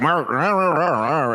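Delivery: blustering nonsense in a hammed-up Texan accent. Host imitating his colorful Texan client, mock Texan accent. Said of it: "that was a terrible Texan accent".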